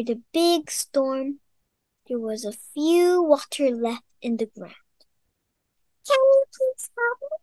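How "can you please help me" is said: tiny high worm voice; small voice. Acted. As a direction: squeak it out, small and quick. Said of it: "said a small voice".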